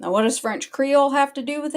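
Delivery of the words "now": ordinary delivery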